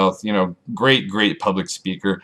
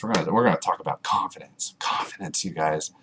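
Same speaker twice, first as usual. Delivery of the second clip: hushed secret whisper; 3 semitones lower, 7 dB quieter than usual. Shown as a demonstration. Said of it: "unvoiced whisper".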